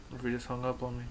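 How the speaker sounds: usual speaking voice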